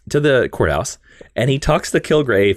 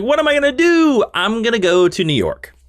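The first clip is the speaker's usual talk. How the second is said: higher-pitched mock wail of a question; acted Kilgrave; 10 semitones higher than usual. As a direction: put on a lightly exasperated villain's quote. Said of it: "So Kilgrave's like".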